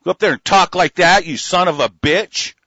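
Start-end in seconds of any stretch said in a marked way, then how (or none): none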